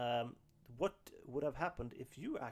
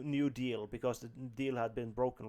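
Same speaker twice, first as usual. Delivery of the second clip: usual speaking voice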